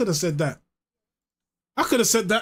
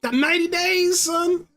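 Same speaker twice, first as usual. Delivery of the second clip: high mock inmate voice; 10 semitones higher, slower than usual. Repeats the prison line in character.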